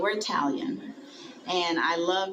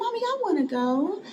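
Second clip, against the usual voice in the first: put-on high child whining to her mother; a small girl tugging at mommy; little girl voice. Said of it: "I said".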